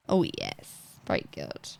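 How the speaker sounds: very low, soft approval; deep, quiet savouring voice; low relish. Pretend. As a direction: sink deep and soft with satisfaction. Savouring the build again.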